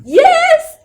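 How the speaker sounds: sudden loud, high burst of yes; excited yes. Real, not acted. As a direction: blurt it out, thrilled. Eager yes to handsome men.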